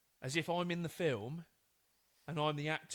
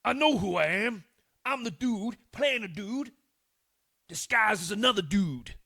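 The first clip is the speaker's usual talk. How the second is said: loud, higher Tropic Thunder impression; film quote impression; 5 semitones higher, 11 dB louder than usual. Shown as a demonstration. Doing a film line for a quiz, loud and higher than usual. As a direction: belt it out like the movie character. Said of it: "I'm gonna try and do the voice".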